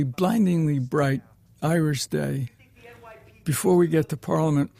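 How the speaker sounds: normal talking voice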